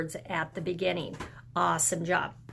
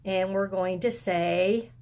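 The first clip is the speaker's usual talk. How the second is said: ordinary delivery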